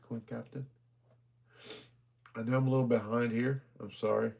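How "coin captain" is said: softer, checking the name again; half-voiced second try at the name; quiet recheck. Real, not acted. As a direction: mutter it back to yourself, unsure. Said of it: "Is that what that says?".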